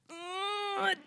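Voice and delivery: put-on high, drawn-out child voice after a soft strained hum; little boy stalling. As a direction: imitate a kid on the toilet calling to Dad. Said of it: "then I just hear this".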